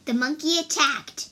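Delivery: plain reading voice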